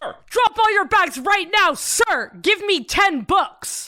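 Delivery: high barking drill sergeant; stiff barking toy soldier. Pretend. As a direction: yell orders like a toy sergeant.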